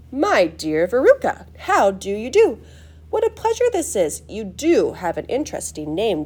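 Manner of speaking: swooping, emphatic, gushing pleasantries; delighted, fluty showman's chatter; eccentric host, effusive. Acted. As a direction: greet grandly, leaning hard on key words. Story figure greets guests effusively.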